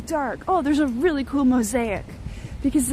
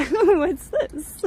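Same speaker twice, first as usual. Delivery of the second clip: laughing, a bit louder; laughing aloud; 5 semitones higher, 4 dB louder than usual. Real, not acted. Streamer spotting a detail in the mosaic, laughing. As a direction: say it again through a laugh.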